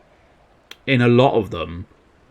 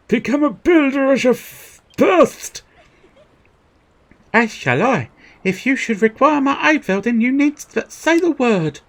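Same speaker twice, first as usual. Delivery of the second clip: much higher, formal character voice; high game character; 3 dB louder than usual. Acted.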